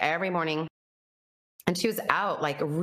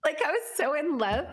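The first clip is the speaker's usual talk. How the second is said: higher voice on the edge of tears; tearful; 9 semitones higher than usual. Real, not acted. Recalling her love for God, voice close to crying. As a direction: let the tears catch in it. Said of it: "And I started crying".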